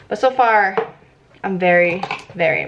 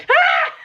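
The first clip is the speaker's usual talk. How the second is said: sudden high yelp of fright; a real short shriek at the mess; a loud, high startled ah; startled scream; 4 dB louder than usual. Real, not acted. Blush splatters, startled cry.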